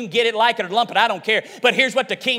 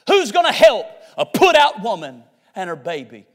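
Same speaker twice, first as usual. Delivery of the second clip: emphatic rhetorical question; 3 semitones lower than usual. Real, not acted. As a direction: run together, punched openings dropping low. Question repeated with more force.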